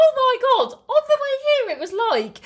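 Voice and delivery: high, loud burst of excitement; excited gasp. Real, not acted. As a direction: gasp and blurt it out. Sudden gasp, excited story start.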